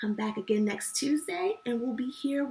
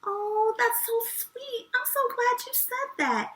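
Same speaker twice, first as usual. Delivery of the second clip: high, slow, tender aww; touched and sweet; 10 semitones higher, slower than usual. Real, not acted. Touched by child's answer.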